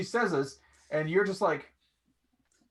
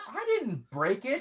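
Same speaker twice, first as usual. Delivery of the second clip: put-on sulky boy denial; 8 semitones higher than usual. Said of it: "And he goes".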